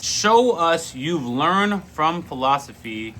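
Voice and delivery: slow, weighty delivery; slow deliberate. Acted. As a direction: lay each word down slowly. Quote repeated for emphasis.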